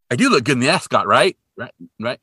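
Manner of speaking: loud, punchy plea for approval; insistent appeal. Retold or imitated. Dean to departing friends about his ascot, mock insistent appeal. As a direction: press them to agree with you.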